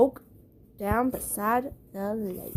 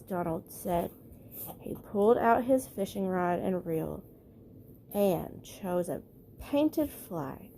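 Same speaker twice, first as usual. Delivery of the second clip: ordinary delivery, nothing marked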